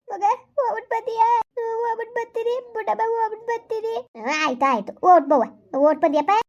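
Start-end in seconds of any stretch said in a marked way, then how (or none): 0.1-3.9 farewell voice. Acted. Mother and daughter say goodbye.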